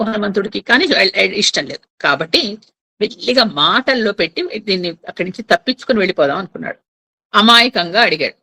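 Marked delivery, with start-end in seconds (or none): none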